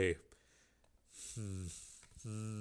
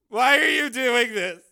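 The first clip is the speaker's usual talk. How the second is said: bewildered plea; 13 semitones higher, 8 dB louder than usual. Acted. Tracy asks Stormy, bewildered.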